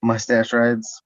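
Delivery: slow, voiced repeat for the listener; stretched-out, fully voiced answer; clear repeat. Real, not acted. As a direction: say it out plainly, drawn out. Repeats after listener asks "Of what?.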